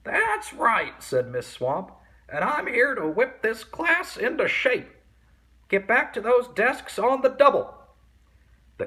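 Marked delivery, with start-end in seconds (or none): 0.0-1.0 witch voice. A narrator's reading. Viola Swamp speaking. 2.3-7.8 high, loud barking orders; stern witch teacher. A narrator's reading.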